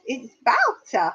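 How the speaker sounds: slow "Wouter" as vow-ter; imitated mispronunciation. Pupil quoting her English teacher's version, imitated mispronunciation. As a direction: insist slowly on "Wouter" with a v start. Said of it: "My English teacher taught me".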